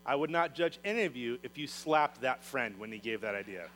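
usual speaking voice

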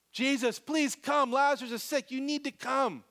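urgent plea. Acted. Acted urgent plea to Jesus.

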